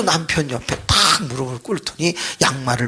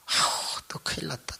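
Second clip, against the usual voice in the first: hushed groan to himself; dreading aside; 8 dB quieter than usual. Retold or imitated. Pastor dreading what the deacon will say, hushed aside. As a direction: whisper it under your breath, bracing for trouble.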